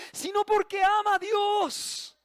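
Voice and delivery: fervent shout. Real, not acted. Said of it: "preacher builds to climax".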